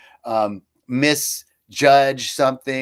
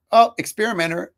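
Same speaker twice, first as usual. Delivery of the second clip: cheerful quip; 8 semitones higher, 6 dB louder than usual. Retold or imitated.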